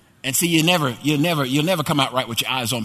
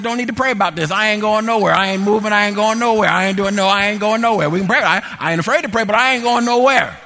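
loud, high, acted-out indignant rant; stubborn protest; 6 semitones higher, 7 dB louder than usual. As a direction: hammer out refusal after refusal, playing up the anger. Retold argument, mounting stubborn refusals.

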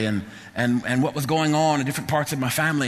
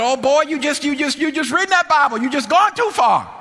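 high, loud mock outburst; mama telling off; 12 semitones higher, 5 dB louder than usual. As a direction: imitate a worked-up mother scolding her son. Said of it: "crying and telling me off at the same time".

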